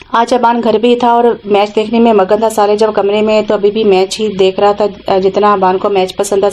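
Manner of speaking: plain reading voice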